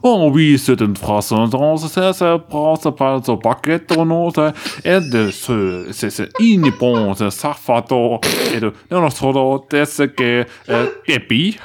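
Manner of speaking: lightly put-on fake French babble. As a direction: mumble vague French-sounding words. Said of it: "das klang grad wie so ein Fake-Französisch".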